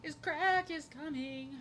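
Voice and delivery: a playful drawn-out singsong; high, slow, drawn-out voice. Pretend. Repeating a phrase while drawing.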